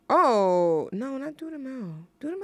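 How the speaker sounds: ordinary delivery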